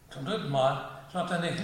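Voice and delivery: ordinary delivery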